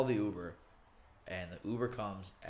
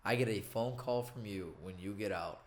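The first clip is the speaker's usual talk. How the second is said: normal talking voice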